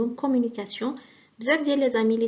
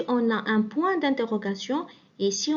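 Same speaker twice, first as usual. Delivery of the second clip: normal talking voice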